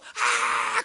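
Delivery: voiceless, drawn-out mock shriek; hoarse fan scream. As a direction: keep screaming with no voice left. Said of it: "목이 쉬어도". (목이 쉰 팬의 비명 흉내)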